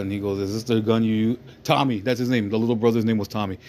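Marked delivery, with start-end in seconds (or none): none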